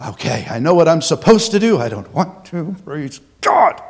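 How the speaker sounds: low, grudging, forced praise; reluctant acting. Preacher acting out an unwilling worshipper, grudging. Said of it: "what you gotta get stirring up".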